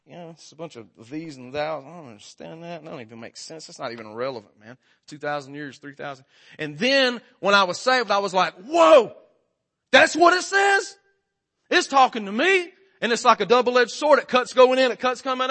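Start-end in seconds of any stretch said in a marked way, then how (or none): 0.0-6.2 low, soft dismissive mumbling; dismissive skeptic. Retold or imitated. Preacher playing his old self before he was saved, dismissive. 8.5-12.7 high, loud amazement; sudden awe, pitched way up; astonished discovery. Retold or imitated. Newly saved man reading the Word, astonished.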